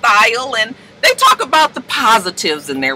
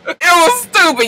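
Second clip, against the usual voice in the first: usual speaking voice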